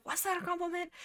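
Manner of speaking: small, high, incredulous question; puzzled disbelief. Acted. Clanmate baffled by Snailstar's venomous compliment, puzzled. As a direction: softly squeak it in mock confusion.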